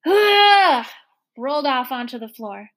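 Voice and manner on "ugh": loud heaving grunt; strained grunt of effort; effortful grunt. A narrator's reading. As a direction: heave with a big "Ugh!". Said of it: "with a supreme".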